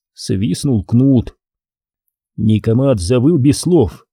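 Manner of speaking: ordinary delivery, nothing marked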